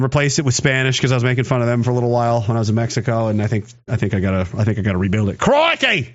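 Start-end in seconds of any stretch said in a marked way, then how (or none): 5.4-6.1 high, punched Australian exclamation; broad cartoon Australian accent; mock Australian accent. Shown as a demonstration. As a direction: burst out in a hammed-up Australian accent. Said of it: "I can't do the Australian accent".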